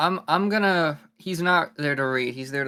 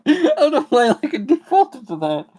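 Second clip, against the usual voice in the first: laughing; 11 semitones higher, 10 dB louder than usual. Real, not acted. Laughing at own silly voice.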